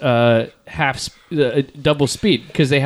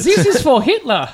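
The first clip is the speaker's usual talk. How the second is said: high, hammed-up mock Austrian accent; cartoonish Austrian accent through laughter; 11 semitones higher than usual. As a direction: do a silly high-pitched Austrian. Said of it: "Can I hear Austrian?".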